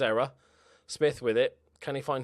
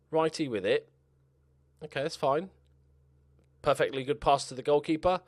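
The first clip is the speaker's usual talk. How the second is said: ordinary talk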